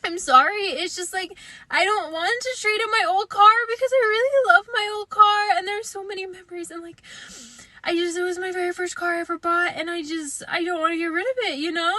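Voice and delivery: imitating a tearful plea, voice dropping away; emotional, near tears. To the car salesman, a mildly tearful plea. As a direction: wobbly voice over trading in an old car. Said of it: "I started to get emotional".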